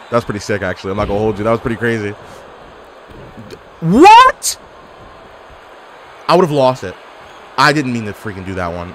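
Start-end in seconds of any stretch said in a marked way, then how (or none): 3.8-4.6 much higher and louder, rising; shocked what. Real, not acted. Gamer shocked by a move in the match, high yelp.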